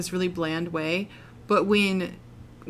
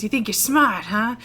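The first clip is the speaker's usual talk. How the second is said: louder, mock-menacing villain sneer; villain taunt; 4 semitones higher, 5 dB louder than usual. Retelling a movie henchman's choking threat, mock menace. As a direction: ham up a gloating henchman. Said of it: "and he says".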